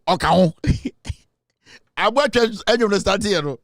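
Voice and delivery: ordinary talk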